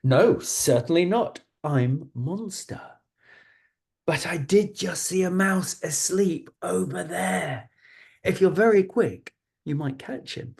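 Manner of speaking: lightly voiced friendly monster, high and startled at first. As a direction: start with a high surprised squeak, then a playful monster. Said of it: "And the mouse says".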